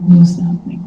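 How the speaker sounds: breathy aside, no voice at all; whisper. Acted. Listener whispering to a neighbour in a whisper. Acted audience reaction to claim.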